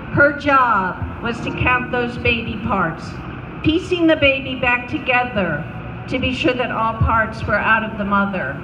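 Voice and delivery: ordinary delivery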